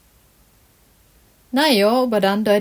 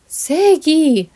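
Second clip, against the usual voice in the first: a startled cry of his name, higher and louder; frightened call; 7 semitones higher, 4 dB louder than usual. A narrator's reading. Luisa cries out to Sigi, startled and frightened. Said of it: "ruft sie erschrocken".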